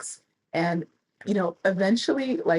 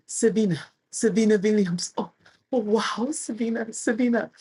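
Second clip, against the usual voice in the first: raised, mock Russian accent; Russian man's accent; 5 semitones higher than usual. Russian fan begging her for an autograph, in a mock Russian accent. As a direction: mimic the Russian fan in a lightly done Russian accent. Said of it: "Russian accent".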